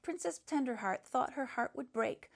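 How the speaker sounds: usual speaking voice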